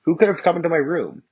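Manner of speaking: high, frantic whisper-scream of panic; panicked girl. Acted. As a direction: gasp it out in a panic. Narrator panics in her room.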